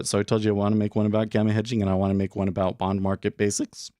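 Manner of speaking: ordinary talk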